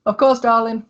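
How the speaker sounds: high, light answer from the back; assistant's reply. Acted. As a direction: call back sweetly from offstage. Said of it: "you hear a voice in the back go".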